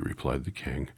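plain reading voice

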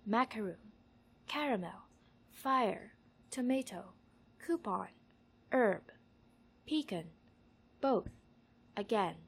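isolated words, each with falling tune; word-list reading. Shown as a demonstration. Saying these words for an accent survey, careful list reading. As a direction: say each word separately and distinctly.

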